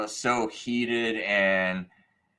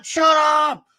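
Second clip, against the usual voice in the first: loud, high mock yell; mad shouting; 8 dB louder than usual. Angry stranger silencing the sign holder, mad shouting. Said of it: "they're mad".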